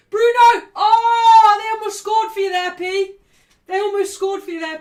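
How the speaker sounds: loud, high shouting at the near miss; excited yell. Real, not acted. Fan yelling as United nearly score. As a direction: roar it at the screen.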